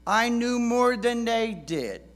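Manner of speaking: put-on cocky teenager. Mimicking his cocky teen self.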